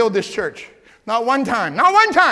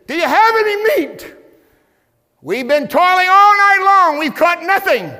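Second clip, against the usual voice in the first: high, loud whining fishermen; complaining fishermen; 10 semitones higher, 8 dB louder than usual. Acted. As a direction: moan it like tired fishermen who caught nothing. Disciples complaining, acted.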